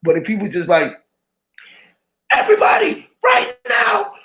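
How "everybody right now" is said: much higher, very loud panicked shout; panicked announcement. Retold or imitated. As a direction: scream the alert to spark mass hysteria. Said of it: "mass hysteria".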